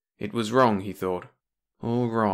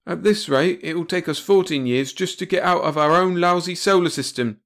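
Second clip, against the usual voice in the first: louder, higher impatient bark; 8 semitones higher, 4 dB louder than usual. A narrator's reading. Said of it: "he barked".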